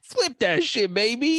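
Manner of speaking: high-pitched, hyped-up call; playful hollering. Pretend. Telling co-host to flip for first song, playful hype. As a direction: squeal it out for the coin toss. Calls for the coin toss.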